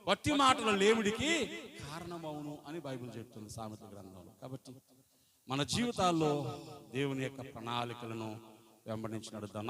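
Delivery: ordinary delivery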